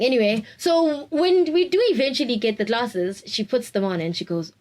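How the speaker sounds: normal talking voice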